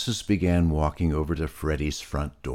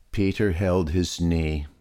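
plain reading voice

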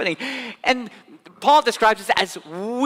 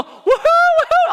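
much higher, louder whoops of joy; elated cheering; 7 dB louder than usual. Pretend. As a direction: cheer wildly from the stands. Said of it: "when they're doing well".

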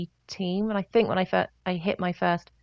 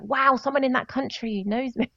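amazed; 6 semitones higher than usual. Retold or imitated. Acting out amazement at distant fans.

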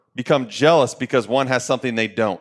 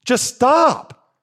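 exasperated outburst; 9 semitones higher than usual. Real, not acted. Exasperated plea to listeners.

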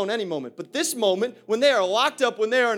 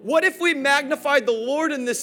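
loud, fervent preaching to the church; rousing sermon delivery; full preaching; 6 semitones higher, 6 dB louder than usual. Acted. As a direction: preach it out and rally the room.

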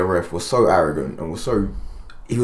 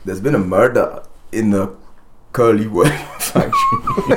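slow, bad Scottish accent, laugh breaking in; a weak Scottish accent attempt; 2 semitones higher, slower than usual. Shown as a demonstration. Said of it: "That's terrible".

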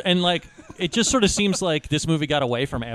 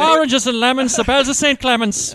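higher, louder stage-Irish lilt; cartoon Irish accent; 7 semitones higher, 6 dB louder than usual. Joking hosts parody an Irish film, loud brogue. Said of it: "Brad Pitt's accent".

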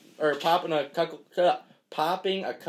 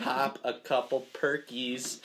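slow careful enunciation; 4 dB quieter, slower than usual. Shown as a demonstration. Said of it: "New tongue twister".

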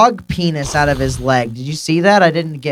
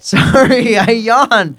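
laughing apology; 8 semitones higher, 7 dB louder, much slower than usual. Real, not acted. Apologizing for a yawn.

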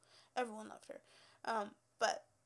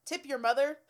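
loud punchline; 11 dB louder than usual. Real, not acted. Joke punchline to viewers.

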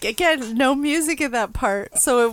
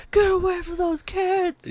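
cop voice; 7 semitones higher than usual. Retold or imitated. Cops voiced in retelling.

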